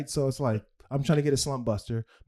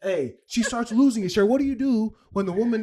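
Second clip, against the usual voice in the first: higher and louder, pushing the story back on track; louder retelling; 5 semitones higher, 6 dB louder than usual. Real, not acted. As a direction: raise the voice to grab the room back. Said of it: "repeats it louder".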